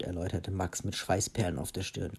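plain reading voice

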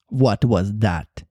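low, slow pirate captain; Hook. Acted. Pirate captain startled by a screech, low demanding cry. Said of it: "cried Hook".

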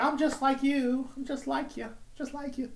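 high, soft, drawn-out fake sincerity; mocking celebrity voice. Mocking celebrities reaching out to fans, high cooing voice. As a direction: coo it in a high mock-relatable voice. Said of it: "they try to reach out like".

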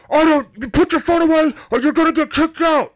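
high, loud imitation of a club DJ; DJ on the mic. DJ threatening to kick a customer out, shouted on the mic. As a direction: shout the warning out to the whole room. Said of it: "the D J gets on the mic".

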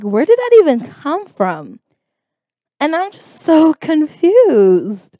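high, loud, incredulous; exasperated bewilderment; baffled. Retold or imitated. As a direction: exclaim in utter confusion, stretching the last words. Baffled reaction to the message.

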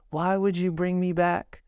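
normal talking voice

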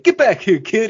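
high, loud chasing yell; mock angry shout. Villain chasing Jamie up a building, mock shout. Villain chases child, retold.

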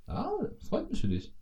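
high, soft sugary voice; fake congratulation. Mocking the classic reply, high and soft. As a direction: purr it high and insincere. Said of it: "eher bei den klassischen".

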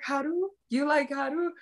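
high, disbelieving Haru?; acting out a stunned friend's question; mock shocked friend. Friend learning the secret, in mock shock. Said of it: "She was just like".

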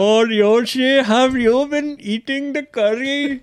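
high, loud Indian-English caricature; Indian accent. Acted. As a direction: tease in a put-on Indian accent. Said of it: "インド人のね". (高く大げさなインド訛り)

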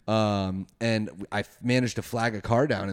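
ordinary talk, nothing marked

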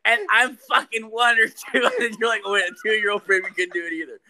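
put-on high, loud, laughing kid's voice; mock little kid voice. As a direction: ham up the toddler caller. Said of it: "the little kid's voice".

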